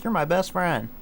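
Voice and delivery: high girly mock voice; girl's reply. Mimicking the girl calling him her best friend, high voice. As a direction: pitch it up like the girl. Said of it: "and then she's like".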